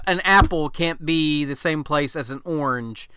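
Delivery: normal talking voice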